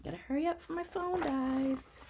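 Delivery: plain reading voice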